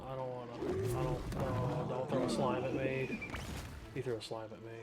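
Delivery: usual speaking voice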